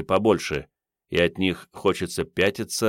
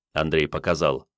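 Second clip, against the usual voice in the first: plain reading voice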